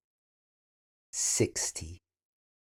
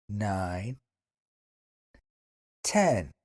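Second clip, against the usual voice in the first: careful counting drill; 4 semitones lower, slower than usual. Shown as a demonstration. Teacher counts aloud for students to listen, slow and deliberate. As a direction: count slowly for beginners to copy. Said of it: "repeat after me".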